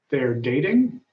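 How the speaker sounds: normal talking voice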